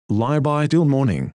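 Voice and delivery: ordinary talk, nothing marked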